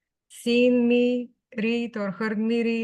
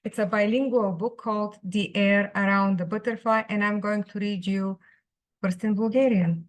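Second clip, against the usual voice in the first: usual speaking voice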